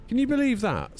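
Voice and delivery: outraged can-you-believe-it; incredulous. Real, not acted. Outraged at TikTok.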